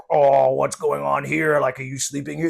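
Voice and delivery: stern park ranger. Retold or imitated. Ranger questioning the speaker in his sleeping bag, officious.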